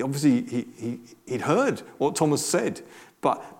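usual speaking voice

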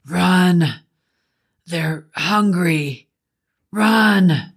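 drawn-out shouted warning; final shout. A narrator's reading. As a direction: shout a last desperate warning. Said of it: "Eric shouted one final time".

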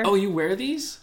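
a high surprised oh. Real, not acted. Cohost learns the pantyhose are hers, surprised.

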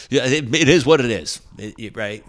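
hammed-up dismissive booker; mocking the booker. Booker's dismissive reply imitated.

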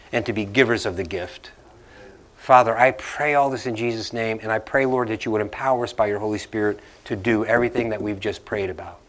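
a pastor praying for the congregation; closing prayer. Acted.

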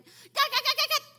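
very high, loud desperate calling; hammed-up believer calling for God. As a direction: shout for God over and over.

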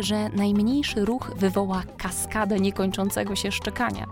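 usual speaking voice

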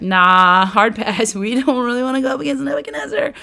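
casual brush-off, quick and laughing at the end; dismissive Egypt. Retold or imitated. Egypt refusing Jerusalem's plea, dismissive and fast. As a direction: wave it off like a reluctant ally. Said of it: "And Egypt's like".

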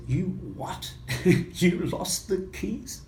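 slow, laughing "you what?"; incredulous, a laugh breaking in; amused disbelief. Retold or imitated. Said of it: "She said".

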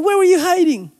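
high-pitched mock child squeal of a question; delighted toddler. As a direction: imitate a little one thrilled to see mom. Toddler greeting returned mother, retold.

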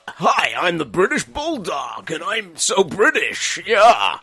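hammed-up wrestler catchphrase, slow and drawn out; British Bulldog. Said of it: "what gets me is the British Bulldog is like".

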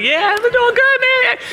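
fake-happy squeak of a greeting; chirping back at the coworker in a high put-on voice; mock chipper reply. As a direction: jump way up into a high, bright-sounding falsetto.

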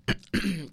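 short rough throat clear. Shown as a demonstration. Host showing the effect of drippy sinuses, throat clearing. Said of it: "clearing my throat".

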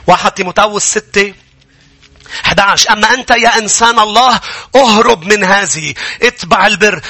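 high, urgent sermon delivery; fired-up preacher, pitched far above normal talk; impassioned preaching. Real, not acted. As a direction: preach with climbing fervour, driving each phrase home. Preaching at full force.

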